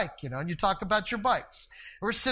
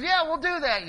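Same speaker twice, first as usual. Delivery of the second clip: calling back; 9 semitones higher, 6 dB louder than usual. Retold or imitated. Yelling back to neighbour.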